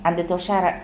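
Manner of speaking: ordinary delivery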